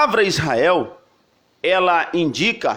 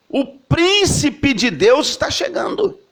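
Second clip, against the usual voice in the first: even higher, slow, ringing announcement; higher herald's proclamation; 8 semitones higher, slower than usual. Retold or imitated. Preacher voicing the crowd announcing the prince of God, higher still. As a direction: call it out again, climbing.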